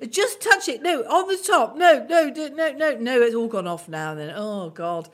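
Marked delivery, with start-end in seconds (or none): 0.1-4.1 louder, higher, overlapping frantic instructions; flustered shouting. Retold or imitated. Family shouting at elderly relatives about the screen, in a flustered way. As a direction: mimic relatives yelling tech help. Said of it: "there were people saying".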